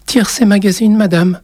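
put-on little boy. A narrator's reading. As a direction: voice a small boy giving his name. Boy answers the witch. (petit garçon timide, voix aiguë)

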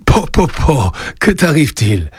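mildly taken aback, asking what's wrong; astonished. A narrator's reading. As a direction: react with gentle astonishment. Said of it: "interrogea Joseph d'un air étonné".

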